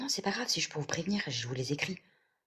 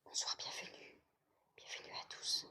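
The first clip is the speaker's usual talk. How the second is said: hushed whispered welcome; whispering; 25 dB quieter than usual. Real, not acted. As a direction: greet them in a whisper. Whispered greeting to newcomers.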